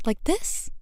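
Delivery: high, eager question; excited high-voiced check. A narrator's reading. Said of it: "I reply with excitement".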